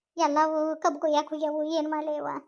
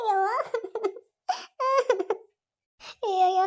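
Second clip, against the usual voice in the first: higher, tearful wailing; crying; 6 semitones higher than usual. Acted. Mother crying beside her daughter in labour, higher wail. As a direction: weep over your suffering daughter. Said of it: "ನೀವು ಆಕಿ ಎದುರಿಗೆ ಅಳಾಕತ್ತೀರಲ್ಲ".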